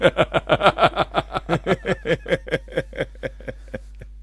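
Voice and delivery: long burst of laughter; loud laughter that gradually dies away; hearty laugh. Real, not acted. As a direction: crack up at your own joke. Laughs after quoting a comedy line.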